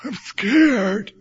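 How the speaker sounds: put-on high, timid child voice; scared little boy. Boy on the pool edge to his dad, high and timid. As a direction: squeak it out like a frightened kid. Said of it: "And I'm going".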